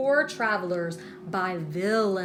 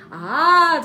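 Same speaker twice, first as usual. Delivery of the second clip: loud, higher sharp Ah; sharp exclamation; 7 semitones higher, 11 dB louder than usual. A narrator's reading. The leprechaun catching on, a sharp loud Ah. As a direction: snap to attention. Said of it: "declared the leprechaun sharply".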